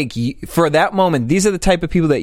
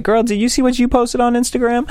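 much higher mocking catty girl voice; 10 semitones higher than usual. Friend mocking judgy girls to her co-host, high catty voice. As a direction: mimic a judgy girlfriend, pitched way up. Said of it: "girls will judge other girls".

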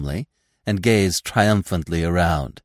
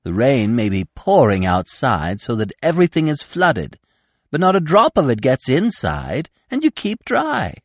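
put-on young deer voice; 8 semitones higher, 5 dB louder than usual. Gobo boasting in character.

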